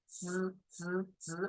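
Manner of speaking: zi with the teeth closed, repeated; buzzing zi. Shown as a demonstration. Teacher drills zi with teeth closed for the class. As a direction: bite the teeth and buzz zi out. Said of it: "bite your teeth and accent out".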